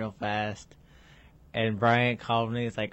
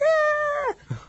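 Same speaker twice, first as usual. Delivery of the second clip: high startled cartoon yelp; cartoon scream; 6 dB louder than usual. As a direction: yelp like a cartoon face flying out. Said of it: "It's like".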